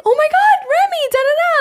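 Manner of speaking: high, gushing mock greeting; imitated fan excitement. Acts singer greeting a favourite vlogger.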